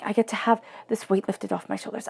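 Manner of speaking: normal talking voice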